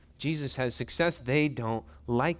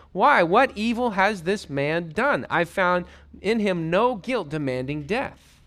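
louder, higher pleading why; exasperated plea; 5 semitones higher, 5 dB louder, slower than usual. Acted. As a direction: Pilate begging the crowd to see reason. Pilate pleads with crowd.

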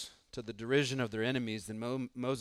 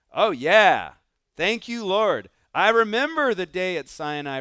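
loud, high, beaming thanks; grateful exclaim; 5 semitones higher, 9 dB louder than usual. Pretend. A Levite gushing thanks to God for the day at Sinai. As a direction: gush your thanks to the Lord.

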